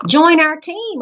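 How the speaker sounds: put-on high, loud girl's call across the field; a girl calls Gabe to join the team, shouting. A narrator's reading. Bright shouted invitation. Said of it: "a girl shouted to Gabe".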